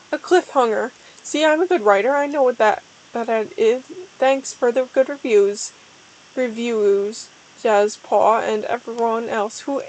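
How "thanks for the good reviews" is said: plain reading voice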